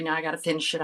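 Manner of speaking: usual speaking voice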